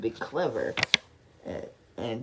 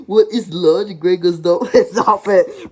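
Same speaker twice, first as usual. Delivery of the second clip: louder repeat breaking into laughter; laughing repeat; 3 semitones higher, 9 dB louder, slower than usual. Acted. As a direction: repeat it, cracking up. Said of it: "looking worried".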